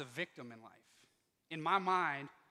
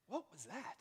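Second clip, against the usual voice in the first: hushed startled question; 9 dB quieter than usual. Retold or imitated. Woken on the couch, asking himself quietly. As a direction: ask it quietly, not sure what's out there.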